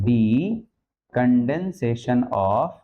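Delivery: decondension stretched very slowly, ending on a rising of; drawn-out dictation. Shown as a demonstration. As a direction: drag the words out for writing. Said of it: "क्या लिख दूं". (बहुत धीरे, ऊपर उठता सुर)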